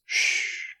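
soft unvoiced shh, a hush to silence the room; warning hush, breath only; warning shush. Shown as a demonstration. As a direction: hiss a quiet warning to be still. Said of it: "a warning voice".

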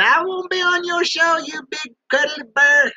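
mock fan message. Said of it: "send me a message saying".

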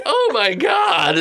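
slow, high, laughing disgust; grossed out. Acted. Teacher sees milk from nose, high disgusted squeal.

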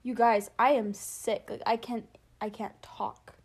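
ordinary talk, nothing marked

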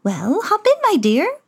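a hint of a merry old fairy; fairy godmother. Godmother greets Cinderella, lightly high and merry. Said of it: "said the woman".

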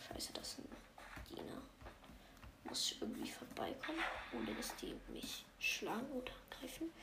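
nervous sneaking whisper. Real, not acted. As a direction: whisper while sneaking past. Sneaking past servants.

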